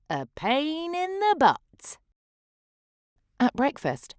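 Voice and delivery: plain reading voice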